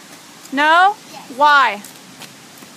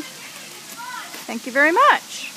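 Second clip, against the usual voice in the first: slow, higher sing-song thank you; 5 semitones higher, 5 dB quieter, much slower than usual. Real, not acted. Mom thanks toddler for sunglasses.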